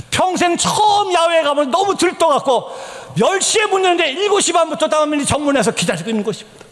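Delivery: high-pitched emphatic storytelling; excited sermon delivery; impassioned preaching. Real, not acted. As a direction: preach it with heightened fervor. Preaches at full force.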